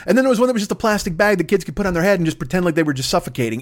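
normal talking voice

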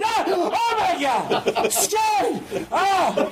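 drawn-out, high mock screams; hammed-up shrieks of terror; scared professor. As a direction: cry out high, startled mid-lecture. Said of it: "he gets just as scared each time".

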